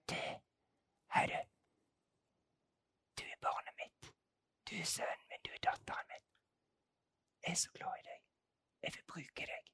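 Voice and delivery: barely audible whisper. Acted. God whispering to His beloved child, whispered. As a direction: whisper tenderly, as if into someone's innermost heart. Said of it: "Men han hvisker, øh, og, og sier".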